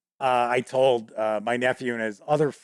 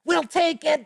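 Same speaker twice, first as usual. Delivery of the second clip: much higher put-on goblin voice, overcome with emotion; tearful goblin leader. Goblin leader moved to tears by awful piano playing, high gushing voice. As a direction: gush like a moved little goblin chief. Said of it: "the leader of the goblins bursts into tears".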